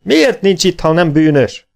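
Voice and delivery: high, loud angry demand; angry accusation. A narrator's reading. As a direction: hurl the question in rage. Gunnar keeps accusing.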